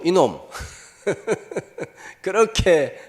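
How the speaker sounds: ordinary delivery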